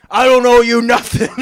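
loud, high imitation of a comic's outburst; mock yell. Comic looks back at the photographer, loud mock yell. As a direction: blast it out in mock defiance.

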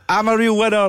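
high-pitched mock yell; imitated winner's scream. Duped mate shouting the catchphrase on air, mock scream. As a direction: imitate an overjoyed prize winner crying out. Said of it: "So he's fucking screamed it".

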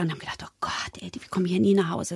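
hushed, fast whisper, as if colleagues mutter among themselves. Retold or imitated. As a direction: whisper the colleagues' worry quickly. Colleagues' worried thoughts, whispered. (Kollegen flüstern sich ihre Sorge zu, schnell und leise)

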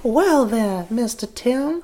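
high, soft Deep South drawl; Deep South accent. Acted. Hypnotist greets her date, hammed-up Deep South accent. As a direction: play a flirty Southern belle. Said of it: "like, deep south".

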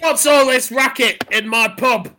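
loud, grand entrance of a pub landlord; booming landlord. Acted. Landlord barging in on a racket in his pub, booming. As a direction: announce yourself to the whole pub, name drawn out.

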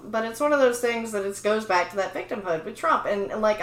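ordinary talk

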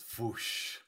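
soft breathy burning-out noise; unvoiced foosh. Describing the computer burning up, a soft fizzle noise. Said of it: "he goes".

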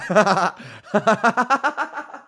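loud high laughing; real laugh. Real, not acted. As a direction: burst out laughing. Laughs at own joke.

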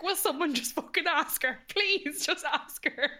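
high-pitched comic begging; mock pleading. Retold desperate wish for proposal.

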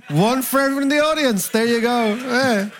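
high-pitched chat-show host impression. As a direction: impersonate a host handing out prizes to the audience.

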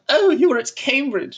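mock posh gasp. Teasing posh mock reaction.

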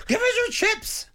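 high, squawking seagull demand; screechy seagull voice. Retold or imitated. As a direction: screech it like a greedy gull. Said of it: "Sounds a bit like a parrot".

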